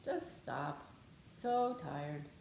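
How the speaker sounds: low, soft, drowsy coaxing; sleepy ego voice. Acted. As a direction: lull yourself to stop like a tired inner voice. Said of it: "my ego does this really funny thing where it says".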